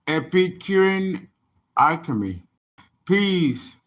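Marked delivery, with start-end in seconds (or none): none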